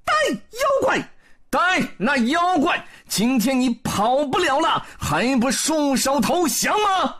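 high, loud battle cry from the sky; shouting Erlang Shen and Wukong. A narrator's reading. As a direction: yell it down at the demon. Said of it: "在空中大喊一声".

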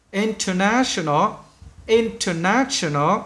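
"International" said slowly with a rise; slow pronunciation. Shown as a demonstration. Teacher modelling a long word, slow careful demo. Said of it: "bạn nghe kỹ này".

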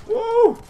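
very high, loud woo; piercingly high gamer whoop; excited whoop. Real, not acted. Excited whoop in live gameplay.

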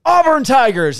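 loud, high rival-team jeer; taunting Auburn Tigers. Retold or imitated. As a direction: bellow it to needle him. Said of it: "And then I go".

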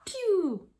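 high-pitched playful "pew"; arrow-shooting sound. Pretend. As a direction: imitate an arrow being fired. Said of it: "shoot an arrow like this".

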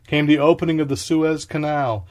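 ordinary delivery

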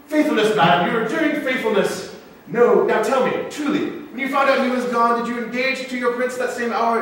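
heated, high-pitched interrogation; mock accusing. Masked pirate accusing the princess of faithlessness, mock accusing. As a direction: press her hard, acting out scorn.

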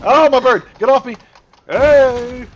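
high, loud gamer yelp; alarmed yell. Real, not acted. Player yelling as their bird gets attacked, alarmed.

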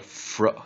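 "fr" sounded alone, repeated with gaps; isolated onset sound. Shown as a demonstration. Teacher drilling the onset, "fr" sounded out in isolation. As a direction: blend f and r into one short "fr", three times. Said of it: "它是念fr".

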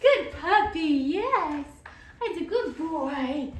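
high, loud gushing praise; excited dog praise. Real, not acted. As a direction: celebrate the dog's success. Praising dog for not flinching.

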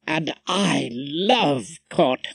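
slow, relished enthusiasm; enthusiastic. A narrator's reading. Wife declaring her love of court, enthusiastic. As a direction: stretch the words out with relish. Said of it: "his wife was enthusiastic".

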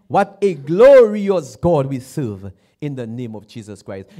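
ordinary delivery

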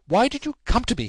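high, loud, exasperated reproach; raving cry. Acted. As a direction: demand an answer, crying out. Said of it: "he cried".

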